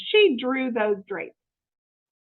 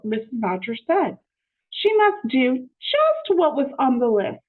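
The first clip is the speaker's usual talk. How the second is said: usual speaking voice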